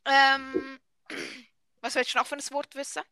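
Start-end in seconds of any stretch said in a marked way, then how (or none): 1.8-3.0 quick Swiss German dialect; brisk Swiss German question. Shown as a demonstration. Switches into Swiss German to test.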